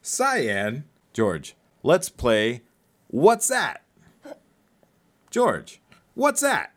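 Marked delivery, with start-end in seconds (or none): 3.1-3.8 high game-show call; high singsong. Acted. Game title called out. 5.3-6.7 high game-show call; high singsong. Acted. Quiz game with toddler.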